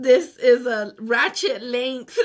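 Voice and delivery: loud, slow, through laughter; laughing. Real, not acted. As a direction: say it cracking up. Laughing about nails.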